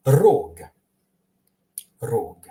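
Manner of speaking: "rog" with a trilled opening R; rolled R. Shown as a demonstration. Teacher showing an example word, rolled R in "rog". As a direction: roll firmly into "rog". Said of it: "Notice the R in horn".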